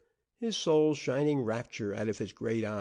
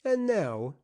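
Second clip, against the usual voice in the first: booming, higher and louder ringing call; stentorian announcement; 9 semitones higher, 5 dB louder than usual. A narrator's reading. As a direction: announce it grandly to the whole room. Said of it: "announced in stentorian tones".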